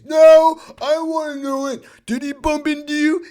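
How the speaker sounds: high, pushy, accusing voice; confrontational dad. Retold or imitated. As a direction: get in his face. Parent demands answer.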